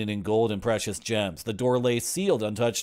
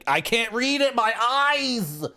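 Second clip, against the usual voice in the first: high strained complaint; 13 semitones higher, 4 dB louder, slower than usual. Real, not acted. Complains about unreadable text.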